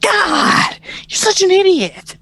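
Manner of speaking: high, loud hammed-up shout through laughter; mock furious dad. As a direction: yell at the clueless son, way up high. Mock dad yelling at son.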